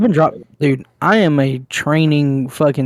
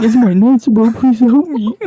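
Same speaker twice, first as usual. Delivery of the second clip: higher, whiny cries for help; imitating crying little brother; 8 semitones higher, 5 dB louder than usual. Mocking his crying little brother begging for help, whiny and higher. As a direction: plead like a scared kid. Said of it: "He's like".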